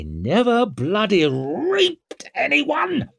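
high, drawn-out outraged cry; frothing outrage, each word stretched; screamed. A narrator's reading. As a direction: build to a slow, high-pitched scream. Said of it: "screamed the last words at the very top".

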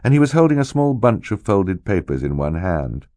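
ordinary delivery, nothing marked

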